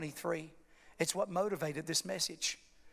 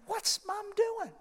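a slow, much higher puzzled question; surprised; 12 semitones higher, slower than usual. Retold or imitated. Pastor recalls the mother grabbing the baby, slow high surprise. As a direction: let the surprise lift it way up.

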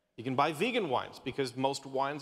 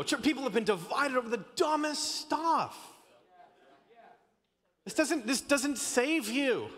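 higher, impassioned preacher push; fervent preaching; 7 semitones higher than usual. Acted. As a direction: preach it hard to the congregation. Preaching at full force.

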